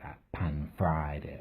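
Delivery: "pan-fried it" whispered with contempt, slowly; scornful whisper. Shown as a demonstration. Man mocking the posh phrase "pan-fried it" in a contemptuous whisper. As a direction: sneer "pan-fried it" under the breath. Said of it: "Oh, that's what I hate".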